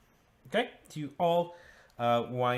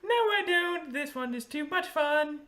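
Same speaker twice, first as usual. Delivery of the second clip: very high singsong creature voice; gleeful taunting creature. Acted. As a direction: refuse gleefully in a high squeal. Game master answers as Runcible.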